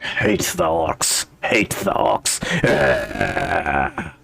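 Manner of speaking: low, slow echo of hatred; low, drawn-out creature voice ending in a groan; old dwarf muttering. Acted. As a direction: chew over the words in a mad dwarf's voice. Dwarf echoes the player's words.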